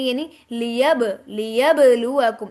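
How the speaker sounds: لیبلوکم with a bouncing, echoing ب; qalqala on ba. Shown as a demonstration. Teacher demonstrating qalqala on لیبلوکم to students. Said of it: "با کے اوپر کلکلا کریں گے".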